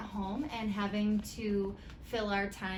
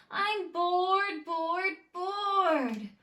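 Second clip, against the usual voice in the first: higher, louder whiny complaint; bored whine; 9 semitones higher, 6 dB louder, much slower than usual. Acted. Gertie complaining to her mom that she is bored, whiny.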